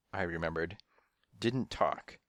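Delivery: ordinary delivery, nothing marked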